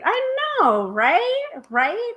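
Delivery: very high, squeaky delight; gushing agreement. Real, not acted. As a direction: gush along, pitch shooting way up. Gushing agreement with a sweet comment.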